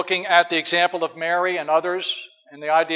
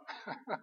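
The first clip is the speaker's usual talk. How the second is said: short laugh; 3 semitones higher, 17 dB quieter than usual. Real, not acted. Pastor laughs at own line.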